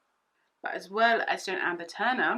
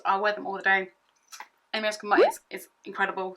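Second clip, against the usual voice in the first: normal talking voice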